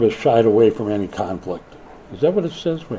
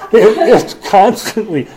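higher and louder, laughing through the words; 9 semitones higher, 7 dB louder than usual. Real, not acted. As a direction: gush about the dog while cracking up. Laughing about his jumping dog.

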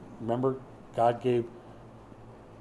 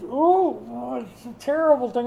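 high, louder mock disgust; hammed-up yuck from the baby; baby's disgusted groan; 9 semitones higher, 5 dB louder than usual. Baby tasting strained peas, high hammed-up disgust. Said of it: "like that".

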